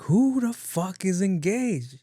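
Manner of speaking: high, drawn-out incredulous question; acted disbelief. To a crying friend on the phone, exasperated disbelief. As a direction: demand it in exaggerated bafflement. Acting out his baffled reply.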